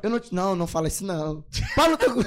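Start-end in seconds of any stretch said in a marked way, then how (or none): 1.8-2.2 playful loud retort. Real, not acted. Joking retort to a friend.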